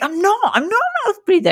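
indignant denial. Retold or imitated. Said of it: "And I was like".

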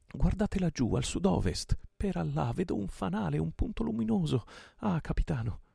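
high, loud, excited calling out; excited lookout. A narrator's reading. Pirate points out a light to his captain, excited high cries. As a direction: call the sighting out, pitch soaring.

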